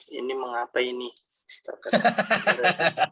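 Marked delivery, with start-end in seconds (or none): 1.5-3.1 laughing. Real, not acted. Laughing at a wrong click.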